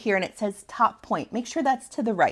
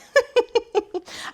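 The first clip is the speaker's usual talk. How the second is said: high, bright burst of laughter; real laughter at herself; big laugh. Real, not acted. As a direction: laugh it off, caught mid-ramble. Laughs at her own tangent.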